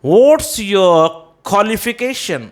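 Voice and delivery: high, slow question, qualification as "kholification"; right pronunciation. Shown as a demonstration. Teacher modelling the question, qualification as "kholification". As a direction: qualification without W, KHO first. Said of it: "बोलेंगे".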